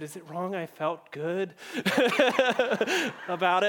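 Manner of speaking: giggling through a sheepish admission; laughing confession. Retold or imitated. Mom admitting she punched her son, laughing. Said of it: "And she said".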